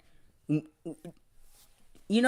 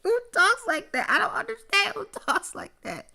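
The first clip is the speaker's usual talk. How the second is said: very high, squeaky disbelief; tiny falsetto of exasperation; baffled squeal; 14 semitones higher, 4 dB quieter than usual. Real, not acted. Baffled, laughing after his outburst.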